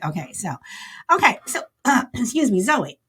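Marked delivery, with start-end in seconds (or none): none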